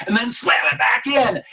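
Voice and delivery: forceful command. Shown as a demonstration. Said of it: "hypnotist commands slam back".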